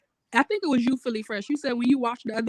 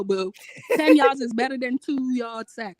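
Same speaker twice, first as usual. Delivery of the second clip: ordinary delivery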